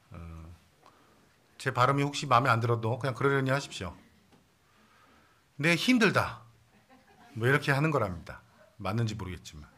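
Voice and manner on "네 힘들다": "네, 힘들다" in a light Gyeongsang accent; a hint of Gyeongsang dialect, said the native way. Shown as a demonstration. Pastor tries out the Gyeongsang version for laughs, mild Gyeongsang accent. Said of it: "경상도 버전으로 하면".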